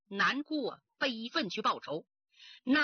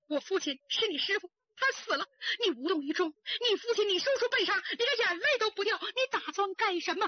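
high, sobbing reproach; 哭着控诉; tearful accusation; 7 semitones higher than usual. A narrator's reading. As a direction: cry out at her heartlessness. Grieving, accuses her sister.

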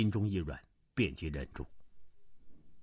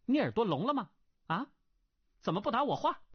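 angry demand; 8 semitones higher, faster than usual. A narrator's reading. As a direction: press him sharply for an answer. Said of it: "那女郎怒道". (略带怒气的质问)

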